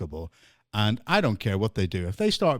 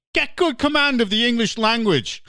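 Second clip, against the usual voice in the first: mock retort; 10 semitones higher, 4 dB louder than usual. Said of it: "the first thing I'm gonna turn around to them and say".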